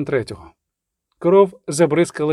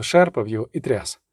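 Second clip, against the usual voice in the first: usual speaking voice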